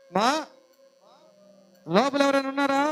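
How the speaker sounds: loud call at the door; old man calling loudly to whoever is inside. Acted.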